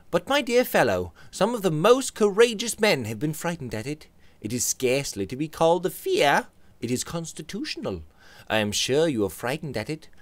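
Robert voice. Acted.

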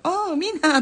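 high, loud "oh" of happy discovery; soaring mock-delighted call; retold delighted greeting. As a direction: exclaim high, imitating someone thrilled to find allies. Retold delighted reaction to the group.

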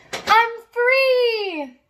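high, bright yelp of freedom; yelping with joy. Acted. Dog yelps to the mountains, high and excited. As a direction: cry it out like a dog set loose. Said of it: "he yelped".

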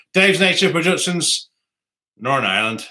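put-on reading a viewer's name in a light Northern Irish accent. Vlogger reading a viewer's name, in Northern Irish accent. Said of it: "Sorry for the accent there".

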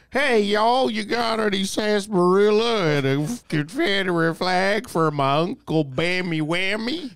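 high-pitched, hammed-up Southern drawl; mock Southern accent. Guest teasing a Southern-born comedian, mock Southern accent. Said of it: "Why don't you have a southern accent?".

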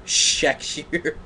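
Sexier? in disbelief, a rising question; can't believe the word she just read; incredulous echo. Real, not acted. Reader repeats the word in disbelief, incredulous.

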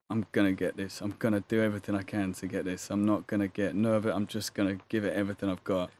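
low, soft self-talk; quiet resolve. Retold or imitated. Actor psyching himself up before the audition, quietly determined.

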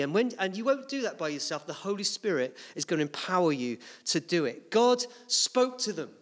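normal talking voice

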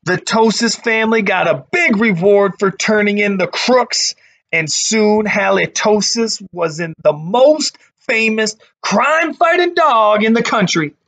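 put-on lively read-aloud voice for kids; storybook narration. A narrator's reading. Teacher reading a picture book to her class, lively storytelling.

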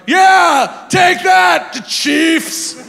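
high-pitched mock shout; forced taunting cheer. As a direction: jeer at a team you barely care about. Acting out forced taunt at team.

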